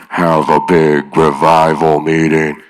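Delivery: slow, deep, booming divine voice; voice of God. Retold or imitated. Jesus speaking in a big dream, slow and deep. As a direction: speak it like a voice from heaven.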